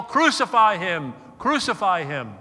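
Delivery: loud mock crowd shout; crowd yell. Preacher voicing the Jerusalem crowd, loud yell. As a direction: shout it like the mob. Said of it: "they were gonna yell".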